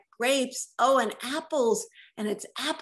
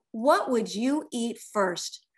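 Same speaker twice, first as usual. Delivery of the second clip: plain reading voice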